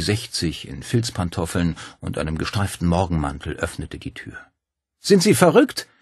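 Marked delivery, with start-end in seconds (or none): 5.0-5.9 much higher, louder indignant scolding; an old woman woken at night, snapping; scolding outburst. A narrator's reading. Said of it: "schimpfte sie augenblicklich los".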